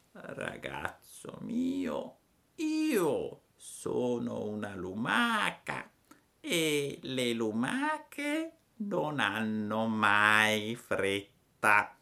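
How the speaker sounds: lentissima e pacata; lumaca flemmatica. A narrator's reading. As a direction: ogni parola senza fretta. La lumaca risponde. (la lumaca risponde con flemma, lentissima)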